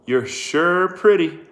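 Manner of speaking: put-on slow, leering drunk sheriff. A narrator's reading. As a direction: leer at her slowly.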